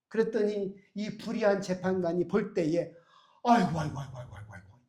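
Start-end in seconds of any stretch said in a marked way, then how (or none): 3.4-4.8 exasperated groaning. Acted. Fed-up judge groaning at widow.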